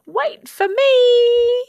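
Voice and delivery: long, high yell. A narrator's reading. Baby Bird calls after the departing hatchlings, drawn-out high yell. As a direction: call out loud and high, drawing it out. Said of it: "Baby took a big run up and yelled".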